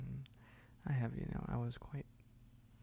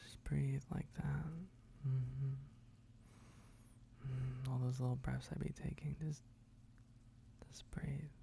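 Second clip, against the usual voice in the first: slow calming whisper; slow, calm breathing guide in whisper; soothing whisper. Acted. Guiding breathing.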